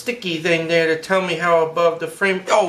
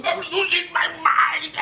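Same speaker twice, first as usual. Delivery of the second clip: mock wail; 9 semitones higher than usual. Mock drawing withdrawal.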